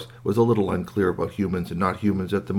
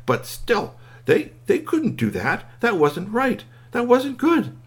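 climbing, higher-pitched protest; distressed narration; 13 semitones higher, slower than usual. A narrator's reading. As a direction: plead that it is wrong, rising in pitch. Toy's upset thoughts in narration.

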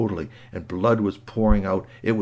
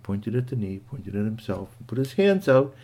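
ordinary delivery, nothing marked

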